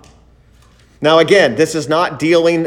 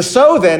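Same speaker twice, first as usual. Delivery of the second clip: "so then" higher and louder, weight on "so"; stress on so then; 7 semitones higher, 4 dB louder than usual. Shown as a demonstration. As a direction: punch "so then" to show it points back. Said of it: "is there".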